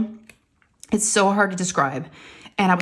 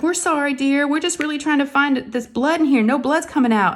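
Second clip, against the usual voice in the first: high-pitched imitation of fussing nurses; apologetic nurse; 6 semitones higher than usual. Nurses hunting for blood in her arm, apologetic. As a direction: soothe the patient in a higher put-on voice.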